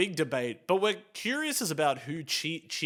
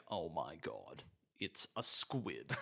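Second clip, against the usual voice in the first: much lower, much softer, slow deadpan; deadpan action hero; 11 semitones lower, 13 dB quieter, slower than usual. Retold or imitated. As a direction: murmur it like a gravely serious hero. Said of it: "he's like".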